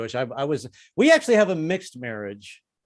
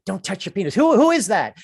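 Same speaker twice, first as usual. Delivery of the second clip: startled; 5 semitones higher, 6 dB louder than usual. Retold or imitated. Startled by inner voice.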